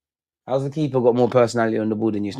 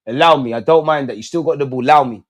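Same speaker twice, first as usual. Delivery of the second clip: ordinary talk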